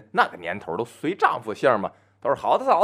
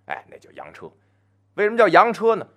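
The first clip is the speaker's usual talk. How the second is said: ordinary delivery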